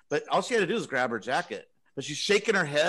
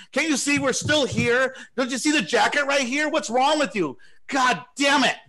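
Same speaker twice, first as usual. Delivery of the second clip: high-pitched mock tirade, louder throughout; retold angry yelling; 10 semitones higher, 5 dB louder than usual. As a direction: imitate an irate woman telling someone off. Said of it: "starts yelling at me really bad".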